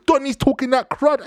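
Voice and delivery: usual speaking voice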